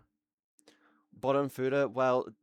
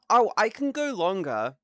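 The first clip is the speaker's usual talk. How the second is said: boastful; 7 semitones higher, 7 dB louder than usual. Real, not acted. Bragging burst.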